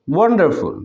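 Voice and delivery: Wonderful with one pressed, then falling; careful model of wonderful, first syllable stressed; stressed syllable demo. Shown as a demonstration. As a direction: lean on the start of wonderful. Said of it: "ঠিক একইভাবে".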